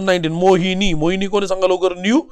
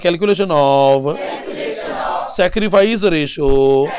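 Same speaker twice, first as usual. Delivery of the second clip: drawn-out class prompt; 2 semitones lower, much slower than usual. Real, not acted. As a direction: draw it out for the class to join in. Teacher cueing class to chant along. (वर्गाला सोबत म्हणायला लावत, शब्द लांबवत)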